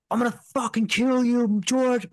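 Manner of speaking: threatening yell. Retold or imitated. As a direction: snarl it like the intruder at the door. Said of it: "this guy was like".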